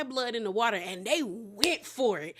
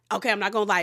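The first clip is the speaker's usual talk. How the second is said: ordinary talk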